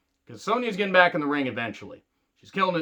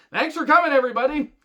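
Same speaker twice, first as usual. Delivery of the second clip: higher, mock cheerful farewell; sarcastic thanks; 9 semitones higher, 3 dB louder, faster than usual. Real, not acted. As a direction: wave them off with fake cheer. Joking dismissal after a laugh.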